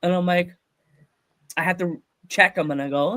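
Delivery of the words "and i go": normal talking voice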